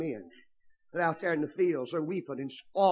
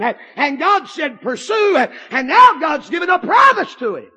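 rising, shouted preaching; preaching at full force; 8 semitones higher, 11 dB louder than usual. Real, not acted. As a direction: build it up into a loud shout.

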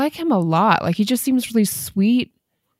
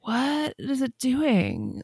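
puzzled; 4 semitones higher, 5 dB quieter, much slower than usual. Retold or imitated. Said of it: "I was like".

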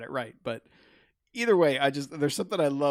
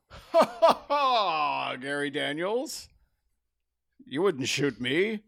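gloating villain laugh; much slower than usual. Retold or imitated. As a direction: laugh at the hero like a movie villain. Killer taunts the hero.

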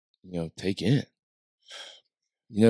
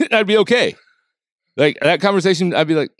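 normal talking voice

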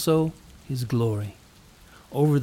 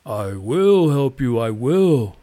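earnest promise; 6 dB louder, slower than usual. A narrator's reading. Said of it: "said the cloud".

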